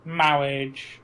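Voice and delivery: drawn-out mock Mawwiage; priest's marriage. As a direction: stretch the word like the priest. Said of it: "the priest in Princess Bride".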